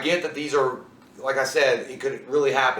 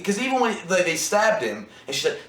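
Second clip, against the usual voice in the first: usual speaking voice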